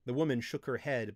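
ordinary delivery, nothing marked

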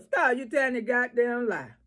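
ordinary delivery

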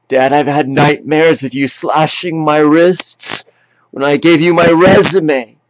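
anguished confrontation. Acted. Grown child confronting Dad over past hurts, anguished. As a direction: plead your case to a father, pitch pushed up. Pained confrontation of father, voice rising.

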